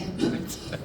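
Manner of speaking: a short embarrassed laugh; a quick sheepish laugh at her own slip; embarrassed breathy laugh. Real, not acted.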